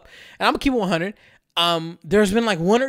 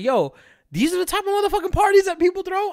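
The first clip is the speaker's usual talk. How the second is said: high, stunned disbelief; shocked disbelief. Retold or imitated. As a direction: sound floored by it. Stunned reaction at wild party.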